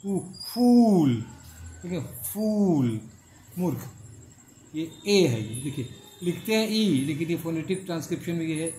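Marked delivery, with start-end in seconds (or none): 0.1-3.0 फूल with a long drawn ऊ. Shown as a demonstration. Teacher showing long oo in fool, slowly. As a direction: stretch the ऊ in फूल. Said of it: "ये है dot वाला लंबा".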